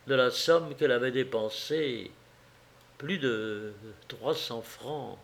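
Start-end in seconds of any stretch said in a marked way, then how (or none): 3.0-5.2 slow, hushed confession; sheepish admission. A narrator's reading. Wife confessing what she spent, sheepish and hushed. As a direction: let it slip out softly, embarrassed. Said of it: "Un peu confuse, rougissante, elle finit par lâcher".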